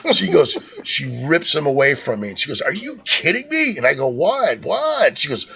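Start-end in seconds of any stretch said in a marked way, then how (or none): 2.6-3.8 high-pitched incredulous wife imitation; mock outraged wife, pitched way up. Retells wife's angry outburst. 4.1-5.2 high, bewildered double question; mock bewilderment, pitch jumping up; baffled retort. Retells own baffled reply.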